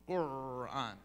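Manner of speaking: "Qur'an" with a long bear-like trill; student's growled Qur'an. Retold or imitated. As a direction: imitate her drawn-out rolled R in "Qur'an". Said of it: "And she did it".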